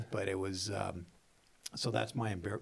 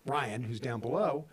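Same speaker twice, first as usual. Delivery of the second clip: ordinary talk, nothing marked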